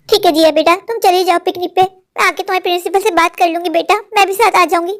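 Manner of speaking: usual speaking voice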